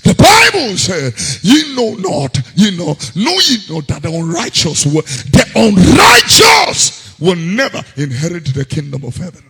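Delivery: thundering pulpit quote; fiery preaching. Acted. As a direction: hammer the verse home. Quoting scripture at full force.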